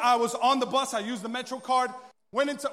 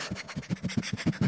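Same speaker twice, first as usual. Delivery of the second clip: wordless snotty crying; exaggerated sniffling sobs; mock sobbing; 4 dB quieter than usual. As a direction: blubber with a runny nose. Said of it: "when you got the boogers coming out".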